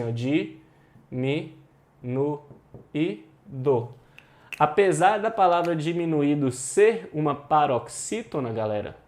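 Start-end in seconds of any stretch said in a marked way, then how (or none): 0.0-3.9 di, mi, nu e do said as separate syllables with pauses; teacher counting out syllables slowly. Shown as a demonstration. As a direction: chop the word into syllables, one at a time. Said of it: "Você tem assim".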